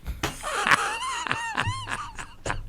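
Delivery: very high howling laugh; shrieking laugh. Real, not acted. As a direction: scream with laughter. Huge laugh.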